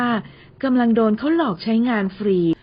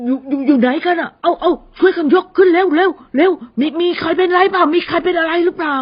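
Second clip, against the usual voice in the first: loud, higher calls after a crash; workers shouting; 6 semitones higher, 5 dB louder than usual. Acted. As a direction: yell for help lifting, urgent. (คนงานตะโกนเรียกกันหลังของล้ม ดังและร้อนรน)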